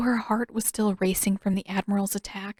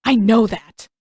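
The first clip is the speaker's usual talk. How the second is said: loud angry snap; snapping; 2 semitones higher, 9 dB louder than usual. A narrator's reading. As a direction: bite it out, loud. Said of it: "Zuko snapped".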